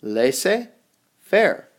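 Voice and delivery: "Laissez-faire" said very slowly as lay-say-fair; French loanword said slowly for the class; slow model pronunciation. Shown as a demonstration. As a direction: stretch each syllable of "Laissez-faire" for learners. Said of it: "It is pronounced laissez-faire".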